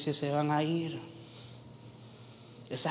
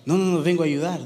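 louder, hurried flustered protest; startled; 3 semitones higher, 7 dB louder, faster than usual. Acted. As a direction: blurt it out as if caught off guard. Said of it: "el joven se asusta".

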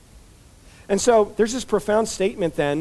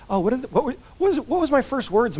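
put-on curious child; 6 semitones higher than usual. Child asking mom, acted.

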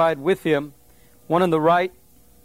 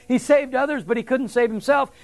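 higher, scornful jeer; mocking taunt; 5 semitones higher than usual. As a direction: sneer it like a leader mocking. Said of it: "that's what they said".

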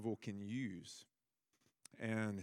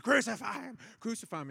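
a high, loud cry from the mob; crowd shouting; 11 semitones higher, 6 dB louder, faster than usual. Retold or imitated. Preacher voicing the crowd before Pilate, high, loud shout. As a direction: yell it out like the crowd baying for blood.

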